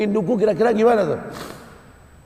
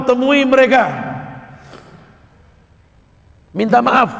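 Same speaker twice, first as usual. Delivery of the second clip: loud, emphatic preaching to the congregation; forceful preaching; 3 semitones higher, 5 dB louder than usual. Acted. Preacher urges the young man to seek his parents, loud and forceful. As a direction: preach it out, driving each line hard.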